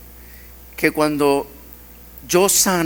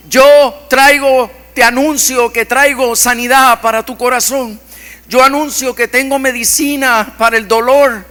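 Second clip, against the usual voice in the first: prophetic proclamation; 4 semitones higher than usual. Acted. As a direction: preach the promise with conviction.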